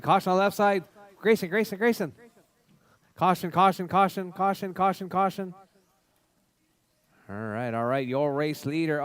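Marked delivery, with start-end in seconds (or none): 0.0-5.5 raised, rapid warning shout; urgent caution call. Real, not acted. Warning drivers of a crash.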